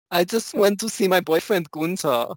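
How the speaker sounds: lightly voiced camp German; camp German accent. Host keeps the joke going, light camp German accent. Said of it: "goes into, like, super camp".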